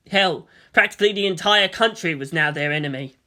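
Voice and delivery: ordinary delivery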